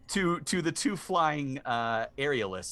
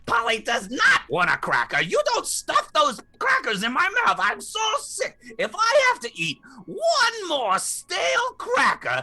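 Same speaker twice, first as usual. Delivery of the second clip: furious shrieking parrot; high outraged bird rant; angry parrot voice; 7 dB louder than usual. Acted. Parrot refuses crackers.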